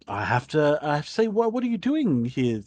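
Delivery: stiffly courteous guard; polite guard. Acted. Guard greeting the dragon rider, stiffly courteous. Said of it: "They're like".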